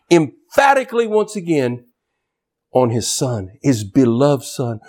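normal talking voice

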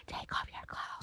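airy ghostly whisper, very quiet; eerie whisper. Retold or imitated. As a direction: breathe it like a hidden voice. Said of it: "sound like".

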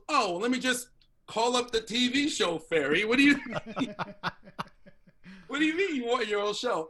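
high, incredulous mocking voice; sarcastic agent. Agent to client wanting his own show, mock-incredulous sarcasm. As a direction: imitate scoffing at an absurd request.

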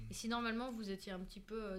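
ordinary delivery

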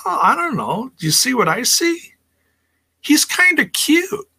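high, coy flirting voice; flirty tease. Retold or imitated. Wrestler swooning over a man, high and coy. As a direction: coo it like you're smitten. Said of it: "and says".

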